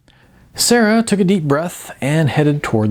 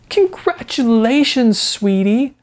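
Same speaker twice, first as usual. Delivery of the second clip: congratulations; 12 semitones higher, slower than usual. A narrator's reading. Coach hugging her.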